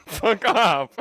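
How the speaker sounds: laughing while telling him off; playful laughing dismissal. Real, not acted. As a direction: break into laughter as you say it. Laughing at friend.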